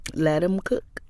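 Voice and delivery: ordinary delivery, nothing marked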